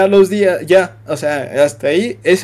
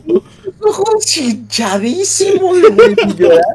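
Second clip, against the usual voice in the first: words squeezed out through real high laughter; can't stop laughing as he tells it; laughing while talking; 11 semitones higher, 4 dB louder, slower than usual. Real, not acted. Telling his friend the embarrassing story, laughing through the words.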